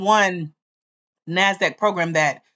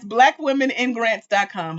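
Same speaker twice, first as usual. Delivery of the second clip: ordinary talk, nothing marked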